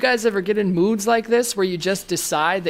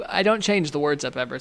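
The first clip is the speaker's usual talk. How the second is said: ordinary delivery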